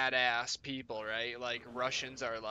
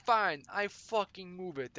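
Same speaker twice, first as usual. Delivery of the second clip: higher, defiant mock Slavic accent; thick accent; 6 semitones higher than usual. Kitchen boss takes up dishwasher's dare, thick accent. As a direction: accept the dare in a thick Eastern European accent. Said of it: "thick accent".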